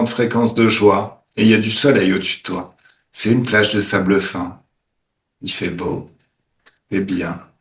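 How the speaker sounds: calm them into the scene; guided meditation. Acted. Guides a healing session.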